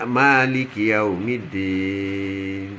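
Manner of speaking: drawn-out, low chanted recitation; slow Quranic recitation. Acted. Preacher reciting to the congregation, slow chant. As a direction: intone the verse slowly and reverently.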